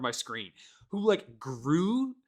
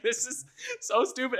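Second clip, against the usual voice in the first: mock disbelief. Reacting to game visuals.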